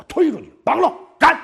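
高声怒喝“斩”; 像一拍桌子后断然下令; 厉声下令. Acted. 孙武下令斩队长. (孙武拍案，喝令武士斩队长)

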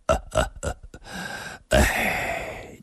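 weak, breathy forced chuckle trailing into a sigh; soft halting laugh, then a sigh. A narrator's reading. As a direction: force out a few feeble chuckles. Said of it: "勉强地笑了".

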